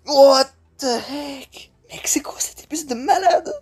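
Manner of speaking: high breathless whisper through laughter; wheezing laughter. Real, not acted. Gamer wheezes in disbelief, out of breath from laughing. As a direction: barely get the words out while laughing.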